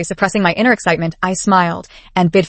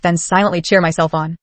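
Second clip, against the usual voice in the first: plain reading voice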